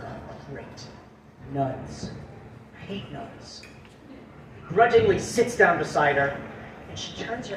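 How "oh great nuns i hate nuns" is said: low, very quiet grumble; disgruntled mutter. Acted. Guy seated next to a nun, disgruntled low mutter. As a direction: grumble it under your breath. Joke: man grumbles to himself.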